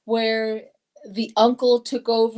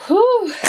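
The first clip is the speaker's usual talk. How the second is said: loud, high exhale of relief; relieved whew; 11 semitones higher, 7 dB louder than usual. Real, not acted. Letting out relief after the election reading. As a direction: blow out the tension, higher and louder.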